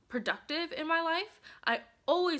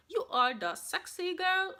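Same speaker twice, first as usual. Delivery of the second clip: sassy self-pep talk; 6 semitones higher than usual. Retold or imitated. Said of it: "I just told myself every day".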